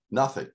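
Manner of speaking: nothing lifted high alone; single out the word nothing; Nothing said up high, set apart; stress on nothing. Shown as a demonstration. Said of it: "I underline the word nothing".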